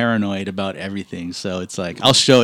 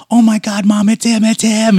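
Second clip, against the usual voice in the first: put-on higher, louder, gushing fan girl; excited girl; 10 semitones higher, 4 dB louder than usual. Girl in the junkyard excitedly telling her mom she spotted him. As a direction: squeal it to her mom like an eager young fan. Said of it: "she was all excited".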